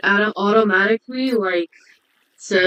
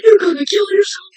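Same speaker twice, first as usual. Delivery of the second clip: high, loud shocked disbelief; 9 semitones higher, 4 dB louder than usual. Real, not acted. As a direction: react in sudden alarm. Shocked at suicide joke.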